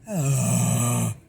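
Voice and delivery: forceful airy sigh; pushed forced sigh. Shown as a demonstration. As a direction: push the air out hard. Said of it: "really push".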